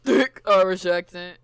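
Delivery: THICK punched loud and drawn out; slow, loud, exaggerated "Thick"; mimicked emphasis. Writer echoes the reader's stumble, "Thick" exaggerated.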